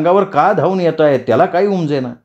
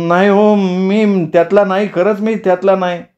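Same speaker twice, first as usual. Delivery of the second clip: pleading denial; 7 semitones higher than usual. Acted. Captive teacher denies chasing village girls, high plaintive pleading. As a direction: insist on innocence in a high pleading voice. Captive begs the mob to believe him.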